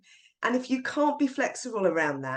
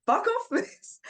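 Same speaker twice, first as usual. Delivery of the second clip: high, laughing repeat of the swear; imitating a cheeky student; defiant kid; 13 semitones higher, slower than usual. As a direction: fling it back cheekily.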